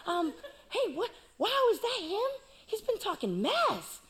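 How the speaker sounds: put-on high, eager kid voice; lightly goofy teen voice; naive young David. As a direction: chirp it like an innocent delivery boy. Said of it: "he's like".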